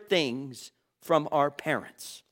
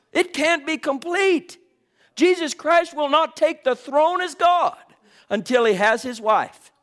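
higher, louder, driving preacher's voice; full-force preaching; 7 semitones higher, 4 dB louder than usual. Acted. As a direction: press the congregation hard.

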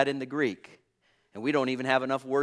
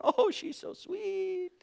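high, slow, syrupy mock flattery; mock flatterers; 10 semitones higher, much slower than usual. Preacher mocking flatterers in a high, slow voice. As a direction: sweetly mimic the flatterers. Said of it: "people who flattered you".

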